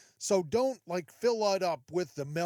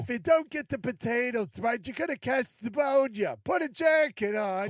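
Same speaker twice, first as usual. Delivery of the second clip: loud, higher nagging mother voice; mock scolding mom, pitched up and loud; 6 semitones higher, 6 dB louder than usual. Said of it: "what my mom used to say".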